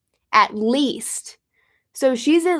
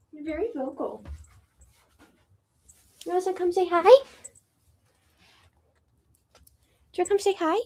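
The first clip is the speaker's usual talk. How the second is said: talking to the cat; 7 semitones higher, much faster than usual. Real, not acted. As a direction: gently coax the cat.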